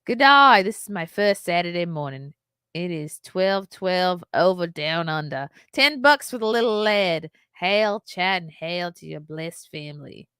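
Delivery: put-on Australian accent. Reading a viewer's message aloud to her audience in an Australian accent. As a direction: ham up a cheery Australian greeting. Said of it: "read this whole thing in Australian".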